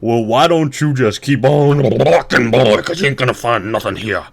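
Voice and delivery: loud folksy bull voice; gruff bull. Acted. Bull sending the ant onward, loud gruff voice. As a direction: bellow it like a country bull. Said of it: "and the bull says".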